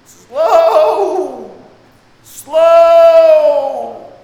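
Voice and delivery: loud high yell of slow. Retold or imitated. Runner warning teammate who took off early, loud yell. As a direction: call it out down the lane, loud. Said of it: "you'll hear number one".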